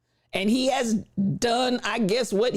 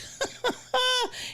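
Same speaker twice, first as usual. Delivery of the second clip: high laugh; 5 dB quieter than usual. Real, not acted. Laughing at her own remark.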